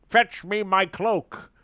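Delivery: slow, loud pompous order; mock lordly command. Imitating Jordan ordering his cloak, slow and pompous. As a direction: command like a haughty lord. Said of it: "He was like".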